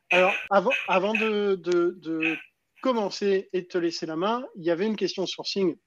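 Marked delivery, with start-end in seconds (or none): none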